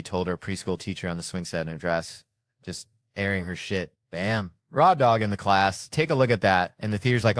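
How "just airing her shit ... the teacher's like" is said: usual speaking voice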